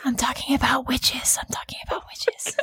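whispered, embarrassed comeback; mock-defensive whisper. Cohost corrected on a word, defensive whisper. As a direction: insist in a whisper. Flustered retort after being corrected.

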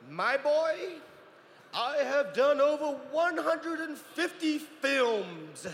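put-on high, slow, grand old actor voice; Christopher Lee. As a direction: play a grand old film star. Christopher Lee answers the boy.

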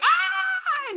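very high-pitched mock scream of terror; scared scream. Playing the action hero panicking in the submarine, scared shriek. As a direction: shriek like a tough guy losing his cool. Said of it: "And gets scared".

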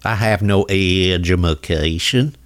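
slow, low Southern drawl; mock Southern accent. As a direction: play a dim Southerner, dragging every word. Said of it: "but the Southern accents".

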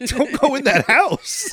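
high, drawn-out plea to the screen; alarmed warning. Acted. Viewer begging the heroine to stay out, alarmed. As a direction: stretch out a high-pitched warning. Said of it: "I'm like".

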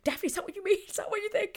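very high, loud frantic question; hammed-up alarm of someone caught out; mock panic. Acts out man caught, pleading.

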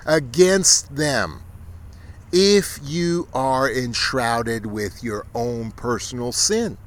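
high, loud sermon thundering against the enemy; fiery preaching. Acted. Preacher warning the sinful against their enemies, loud fiery preaching. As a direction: thunder it from the pulpit.

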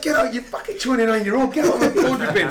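high-pitched telling-off breaking into laughter; mock scolding. Player retells manager ordering lad out of bin, mock scolding. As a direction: imitate an exasperated gaffer, high and amused.

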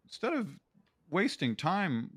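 mocking a preachy workplace presenter; mock HR video voice. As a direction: sneer it like a preachy HR training video. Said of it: "H R bullshit where it's like".

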